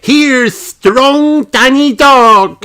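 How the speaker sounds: high, slow, loud call; Peppa calling out. A narrator's reading. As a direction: stretch it out to rouse the crowd. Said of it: "calls Peppa".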